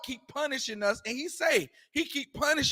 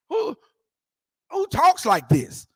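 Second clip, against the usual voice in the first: slow, louder rhetorical question; incredulous challenge; 7 semitones higher, 5 dB louder than usual. Real, not acted. Preacher challenging listeners about the verse's wording, incredulous. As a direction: draw it out in disbelief.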